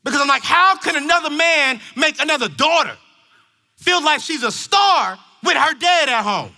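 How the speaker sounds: loud, high, driving sermon voice; full-force preaching. Acted. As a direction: preach it hard to the parents.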